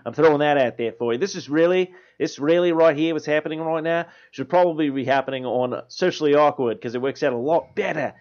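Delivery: animated broad Aussie rant; grumbling, riled-up Australian comic character; Australian accent. Acted. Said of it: "a long rant from an Australian".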